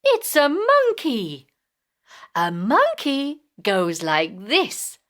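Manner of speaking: usual speaking voice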